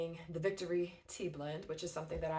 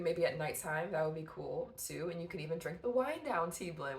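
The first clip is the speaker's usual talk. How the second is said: usual speaking voice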